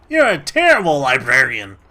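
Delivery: loud exasperated yell; mock outrage. Real, not acted. Gamer yelling at the unhelpful librarian, loud exasperation.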